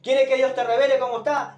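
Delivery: ordinary talk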